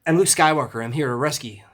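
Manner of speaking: second go at a hurried hero quote, opening high and loud; Mark Hamill's Luke. Acted. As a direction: announce yourself like an eager film hero. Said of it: "hard to talk as fast as Mark Hamill".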